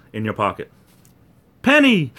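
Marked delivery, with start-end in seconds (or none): 1.6-2.1 excited punchline. Acted. Joke punchline shouted.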